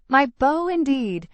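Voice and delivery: high, loud coy protest; mock protest. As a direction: play the coy girl brushing it off. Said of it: "said I".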